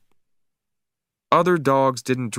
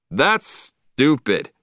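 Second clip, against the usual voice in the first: slow mock shout; 4 semitones higher, much slower than usual. A narrator's reading. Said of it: "he shouted".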